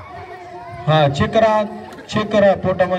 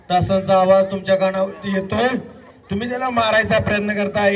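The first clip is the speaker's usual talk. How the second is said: normal talking voice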